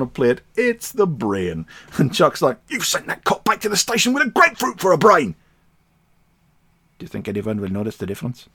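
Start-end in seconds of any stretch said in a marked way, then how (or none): 2.7-5.4 higher, excited comic voice; Chuck's alarmed line. Acted. Podcaster voicing Chuck scolding the scientist, higher voice. 7.0-8.5 put-on fast, soft German-accented aside; deadpan Stein. Podcaster voicing Stein's deadpan reply, soft and fast.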